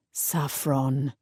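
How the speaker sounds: low, soft rasp of "Saffron". A narrator's reading. Jasper to Saffron, low and rasping. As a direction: breathe "Saffron" low and rough. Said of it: "his voice a rasp".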